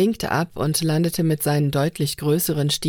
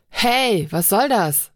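sudden loud call, higher and louder; echoing call; 5 semitones higher, 3 dB louder, slower than usual. A narrator's reading. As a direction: call out sharply across the hall. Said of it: "hallte plötzlich Binos Stimme".

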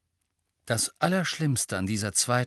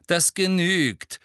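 slow, raised, higher voice; stern cut-off; 8 semitones higher, 6 dB louder, slower than usual. A narrator's reading. Teacher cuts Hermine off mid-objection, loud and stern. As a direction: shut the objection down, loud and drawn out.

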